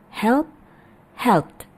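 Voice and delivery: usual speaking voice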